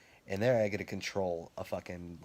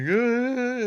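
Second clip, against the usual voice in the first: long, high, very loud holler; rowdy mock yell; 11 dB louder than usual. Imitating the spitter's friend in the maze, rowdy holler. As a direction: hold a big mocking whoop, imitating someone.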